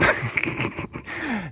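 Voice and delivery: a quick, breathy, high-pitched laugh; short high laugh. Real, not acted. To his viewers after a bold claim, a short high laugh. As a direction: let a small amused laugh slip out.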